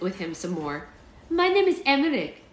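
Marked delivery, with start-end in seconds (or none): 1.3-2.4 high, loud mock German accent; cheery child with a put-on German accent; happy German boy. A narrator's reading. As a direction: proudly introduce yourself as a little German kid. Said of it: "he was happy to tell them".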